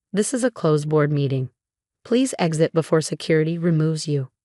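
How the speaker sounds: usual speaking voice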